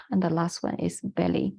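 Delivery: usual speaking voice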